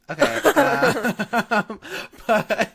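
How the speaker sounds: high laughing okay. Real, not acted. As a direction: talk through a giggle. Laughing after joke.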